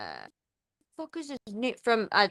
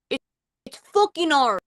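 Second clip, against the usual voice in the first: frustrated; 7 semitones higher, 7 dB louder than usual. Real, not acted. Frustrated at failing the accent.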